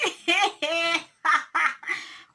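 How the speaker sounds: high, breathy burst of laughter; real laugh. Real, not acted. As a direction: laugh out loud at the absurdity. Laughing at the story.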